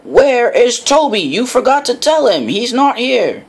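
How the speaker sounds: Sir Topham Hatt cross and loud. A narrator's reading. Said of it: "Sir Topham Hatt was cross".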